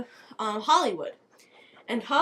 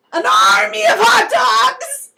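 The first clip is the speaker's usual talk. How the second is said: slow, loud, high triumphant shout; villain proclamation; 10 semitones higher, 12 dB louder than usual. Acted. Valentine announcing his army, a slow triumphant shout. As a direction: bellow it like a mad general.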